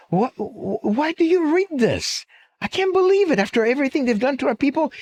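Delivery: high, spluttering mock indignation; appalled disbelief. Friend appalled in retold joke.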